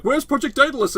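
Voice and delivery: mock urgent. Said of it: "Burnham being like".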